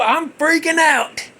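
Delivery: normal talking voice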